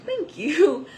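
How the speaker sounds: high bright loud thank you; flattered answer to a stranger's compliment; delighted thanks. Retold or imitated. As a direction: gush back, flattered. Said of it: "And I said".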